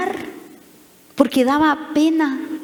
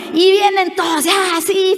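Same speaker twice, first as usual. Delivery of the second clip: excited crowd; 6 semitones higher than usual. Retold or imitated. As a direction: burst out like a happy crowd. Acting excited crowd arriving.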